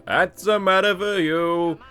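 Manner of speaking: slow, hammed-up Italian accent. Host quoting Charlie's famous line, in a hammed-up Italian accent. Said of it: "I've probably offended Italy again".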